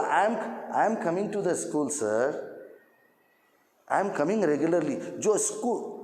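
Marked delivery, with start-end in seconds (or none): 0.0-5.0 slow demonstration sentence with pauses; slow, measured classroom delivery for clarity; slow, paced English. Shown as a demonstration. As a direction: say it slowly, pausing between phrases. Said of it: "slow बोला".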